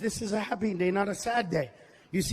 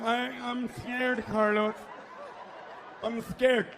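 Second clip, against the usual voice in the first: mock scared audience; slower than usual. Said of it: "Some of you are afraid to laugh".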